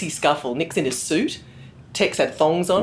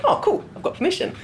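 mock delight; 13 semitones higher than usual. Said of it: "And I went".

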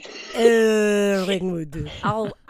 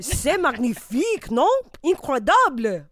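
put-on French accent; 4 semitones higher than usual. Pierre Jambon comic French character.

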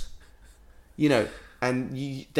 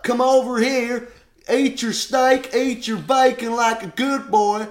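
mock pushy American; 10 semitones higher, 6 dB louder than usual.

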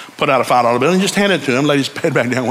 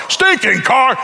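mock grumble; 10 semitones higher, much slower than usual. Mock complaint about own car.

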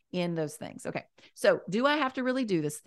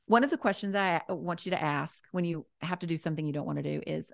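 ordinary delivery

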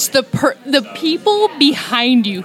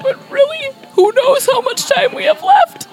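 dramatic grandpa wail. Retold or imitated. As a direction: wail it like a doom-laden grandpa. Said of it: "My grandma's like".

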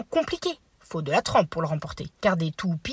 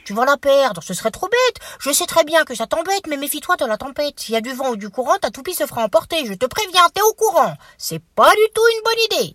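higher, louder fussy aunt voice; scolding aunt; 6 semitones higher, 4 dB louder than usual. Acted. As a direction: nag like a worried auntie. Aunt mole warns tapir.

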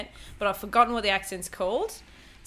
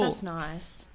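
sulky; 5 semitones lower, 9 dB quieter than usual. Real, not acted. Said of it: "I'm in a bit of a mood".

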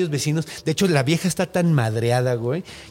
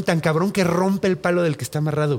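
ordinary talk

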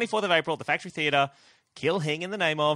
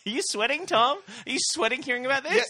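teasing; 13 semitones higher, 4 dB louder than usual. Real, not acted. Teasing the co-host.

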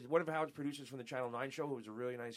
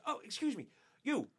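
rude Geraldo; 6 semitones higher, 5 dB louder than usual. Retold or imitated. Geraldo orders the intern around.

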